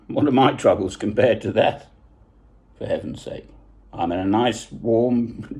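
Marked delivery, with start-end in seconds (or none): 2.8-3.5 for heaven's sake, whispered and dropped low; a hushed, exasperated sigh of words; whispered aside. Real, not acted. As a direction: breathe "heaven's" out under your breath. Dismissing his own troubles.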